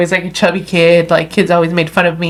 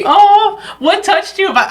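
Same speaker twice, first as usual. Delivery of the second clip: high-pitched, laughing question; 9 semitones higher than usual. Real, not acted. Host asking the guest what touched her, laughing. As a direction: ask through startled, touched laughter.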